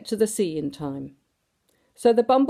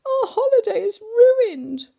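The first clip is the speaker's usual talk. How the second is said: much higher and louder mock wail; 6 dB louder than usual. A narrator's reading. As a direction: wail it like a stage drama queen. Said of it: "wailed Missus Bumblebee".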